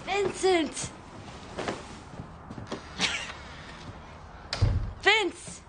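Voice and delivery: high, falling calls; high-pitched name calling. Acted. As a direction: try the name out as a call.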